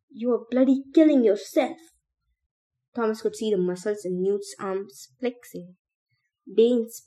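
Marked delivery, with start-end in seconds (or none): 0.1-1.8 higher, louder urgent yell; forceful raised-voice protest. A narrator's reading. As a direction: shout it while wrestling someone. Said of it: "Newt yelled as he tugged".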